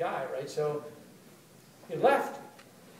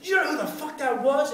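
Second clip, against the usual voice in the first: loud, high, emphatic demand; acted incredulous outburst; mock astonished; 8 semitones higher, 7 dB louder than usual. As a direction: imitate someone flabbergasted that you missed a big shot. Acts out amazed union guy.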